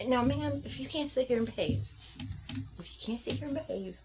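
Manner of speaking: hint of a firm mother scolding, soft; pretend-scolding the doll quietly; mock scolding a child. Mother tells her doll to behave, mild mock scolding.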